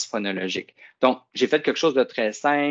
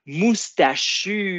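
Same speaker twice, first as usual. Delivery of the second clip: Moustachu very slow and higher; slow syllables; 6 semitones higher, 4 dB louder, much slower than usual. Shown as a demonstration. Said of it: "Dis le mot moustachu avec moi". (Moustachu très lent, syllabe par syllabe)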